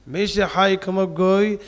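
ordinary talk, nothing marked

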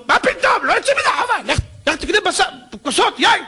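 high, scolding know-it-all dismissing; mock pedantic rebuff. Mocking how we'd answer the poor man. (חיקוי לעגני של פוסק נוקשה)

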